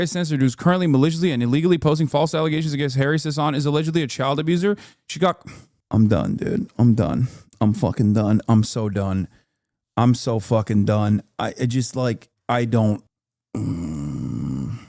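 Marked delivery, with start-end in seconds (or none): 5.9-14.8 low, defeated I'm done; deep exasperated groan. Real, not acted. Fed-up streamer groaning low at the news.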